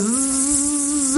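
long droning zzz; a sustained electric buzz; buzzer noise. Retold or imitated. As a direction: imitate a buzzer going off. Imitates the lie detector buzzer.

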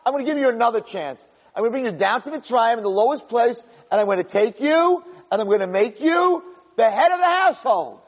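high, quick and grandly promising; God lifting Yosef up to lead the house; God's promise. Retold or imitated. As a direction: announce a second chance with gusto. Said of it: "Hashem said".

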